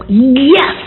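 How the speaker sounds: high, rising "Yes!" of triumph; retold jubilant cheer. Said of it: "I wanted to go".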